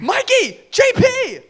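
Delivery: high, loud calls after friends; urgent high call. Acted. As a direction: shout their names as you run. Calling friends while running.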